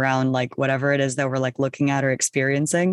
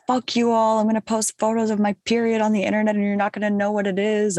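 defiant, raised and higher; rebellious; 6 semitones higher than usual. Retold or imitated. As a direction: throw it out like a rebel teen. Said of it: "really rebellious, like, you know, attitude".

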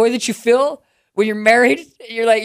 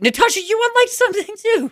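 very high-pitched, wounded disbelief; hurt shock, pitch shooting up; shocked accusation; 14 semitones higher than usual. Acted. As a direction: accuse a friend in a high squeal of betrayal. Discovers friend unliked him too.

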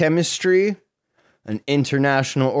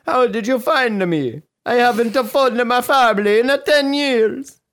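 mock Italian; 10 semitones higher than usual. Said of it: "Gamino reportedly told police".

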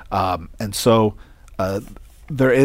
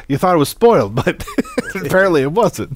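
laughing; 9 semitones higher, 4 dB louder than usual. Real, not acted. Laughs mid-sentence teasing friend.

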